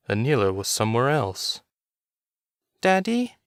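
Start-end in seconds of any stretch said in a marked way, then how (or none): 2.8-3.4 put-on high, small boy calling out; a little boy asking for his father; small boy, unsure, calling Daddy; small child's voice. A narrator's reading. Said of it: "a small voice said behind him".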